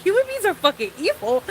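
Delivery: high, exclaimed disbelief; shocked exclamation. Retold or imitated. Recalling her shock in class, high exclaimed disbelief. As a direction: say it as if the diagram just hit you. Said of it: "I was like".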